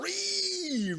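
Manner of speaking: a sudden high mock shriek. Reading chat, he shrieks a joking high ree. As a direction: let out a silly shrill ree.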